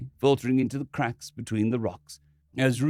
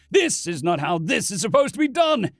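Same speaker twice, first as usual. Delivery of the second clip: angry; 7 semitones higher, 4 dB louder than usual. Acted. Ronson protests angrily.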